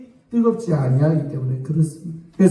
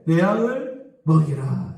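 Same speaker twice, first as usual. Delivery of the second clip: slow solemn Jesus; slower than usual. Retold or imitated. Jesus commands Peter again.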